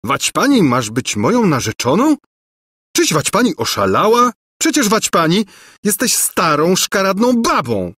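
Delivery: high-pitched, indignant outburst; appalled cry. A narrator's reading. Prince recoiling from the old governess, appalled cry. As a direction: cry out in horrified disbelief. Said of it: "krzyknął".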